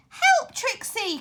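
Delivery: high, slow desperate cry; cry for help. A narrator's reading. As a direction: call the dog to rescue you. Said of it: "cried George".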